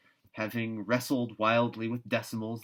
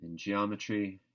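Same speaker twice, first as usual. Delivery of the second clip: usual speaking voice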